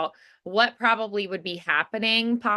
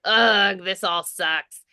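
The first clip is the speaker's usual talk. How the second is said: grumpy groan; slower than usual. Shown as a demonstration. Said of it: "the sharp contrast where at the beginning".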